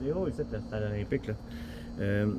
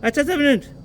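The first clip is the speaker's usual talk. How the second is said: much higher and louder, halting someone; insistent interruption; 10 semitones higher, 6 dB louder, much faster than usual. Real, not acted. Man halting the assistant to object, insistently. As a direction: jump in with raised pitch and volume.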